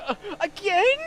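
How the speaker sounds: high, loud disbelieving rise; high-pitched outraged "Nick? Again?"; exasperated friend. Retold or imitated. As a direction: imitate the friend catching on in disbelief. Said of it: "He's like".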